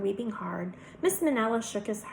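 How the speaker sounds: usual speaking voice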